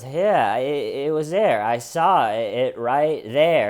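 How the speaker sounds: a hint of a cornered liar; insistent suspect. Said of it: "He goes".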